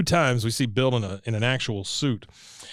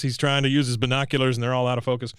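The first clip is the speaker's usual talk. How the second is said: normal talking voice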